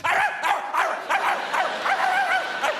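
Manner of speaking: high, loud yippy barking; small dog barking at a falling leaf, very high and loud; tiny dog yapping. Acted. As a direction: yap like a panicked little lapdog. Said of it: "and they go".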